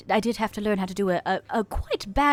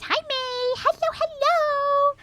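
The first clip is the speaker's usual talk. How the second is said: sing-song greeting; much slower than usual. Acted. Reading a chat greeting in voice.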